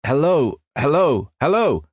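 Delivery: yelling into the mic. A narrator's reading. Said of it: "while Ceausescu yelled".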